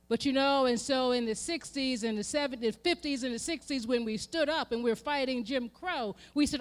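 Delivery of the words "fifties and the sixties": ordinary talk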